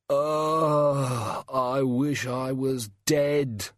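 slow miserable moan. A narrator's reading. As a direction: moan it out like a dying man. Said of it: "it moaned".

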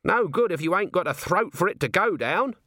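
high-pitched commoner's objection; protesting Nobby. Acted. Nobby turns down the brandy, protesting. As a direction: argue back, alarmed.

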